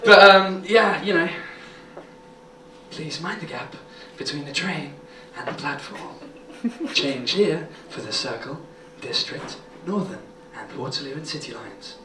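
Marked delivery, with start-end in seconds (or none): 2.9-12.0 seductive tube announcer. Retold or imitated.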